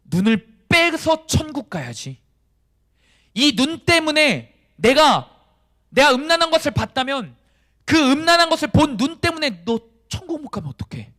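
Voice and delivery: loud, high, urgent preaching; stern, forceful warning. Acted. Jesus warning of losing heaven over sin, loud stern urging. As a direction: hammer out the warning at full force. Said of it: "얼마나 단호하게 말씀하시는지 모릅니다".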